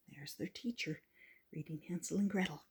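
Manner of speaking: whispered aside to the listener. Real, not acted. Reader to child, whispering over the picture. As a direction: whisper it like a secret. Pointing out picture detail softly.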